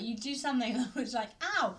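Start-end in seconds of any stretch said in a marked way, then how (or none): 1.4-1.8 higher mock "Ow!"; yelp of pain. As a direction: cry out as if pinched. Acts out being pinched.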